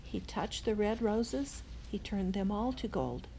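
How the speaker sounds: plain reading voice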